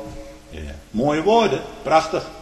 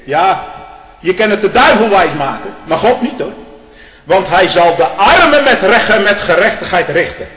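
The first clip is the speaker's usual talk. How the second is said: fiery preacher climbing to a high, loud peak; preaching at full force; 7 semitones higher, 9 dB louder than usual. Acted. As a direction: build the sermon into a roar.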